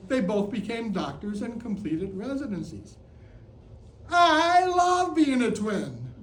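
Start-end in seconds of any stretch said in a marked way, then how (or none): 4.1-6.1 high, loud, drawn-out proclamation; exuberant declaration. Pretend. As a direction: proclaim it slowly to the whole room. Proud declaration to the audience.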